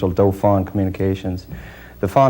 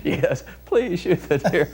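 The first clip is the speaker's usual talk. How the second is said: high, slow mock plea through laughter; amused plea; 8 semitones higher, 3 dB quieter than usual. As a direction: laughingly imitate a pleading deer call. Confirms words hidden in the call.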